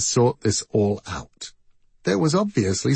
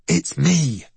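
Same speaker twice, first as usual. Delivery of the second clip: urgent and higher, fully voiced; higher-pitched urgency; urgent whisper; 4 semitones higher than usual. A narrator's reading. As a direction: press to be recognized, urgently. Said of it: "whispered the voice from behind him, urgently".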